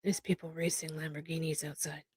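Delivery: very soft, half to yourself; real quiet aside; distracted aside. Real, not acted. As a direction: drop far down in volume, off the conversation. Explains noise outside, aside.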